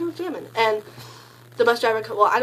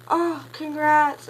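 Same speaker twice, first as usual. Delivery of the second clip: higher, slow, stretched congrats; drawn-out congrats; 7 semitones higher, 4 dB louder, much slower than usual. Retold or imitated. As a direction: drag out the congrats. People congratulating during concert.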